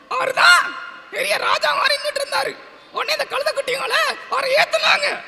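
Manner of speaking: high-pitched puppet storyteller; டிங்கன். Pretend. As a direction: tell a tale in a tiny squeaky character voice. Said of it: "ஒரு கதை சொல்லணும்னு". (கதை சொல்லும் கீச்சுப் பொம்மைக் குரல்)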